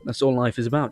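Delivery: normal talking voice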